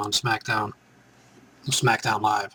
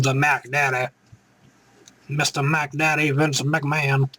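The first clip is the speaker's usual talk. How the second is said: normal talking voice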